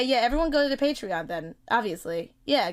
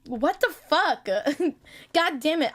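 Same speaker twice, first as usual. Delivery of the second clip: ordinary talk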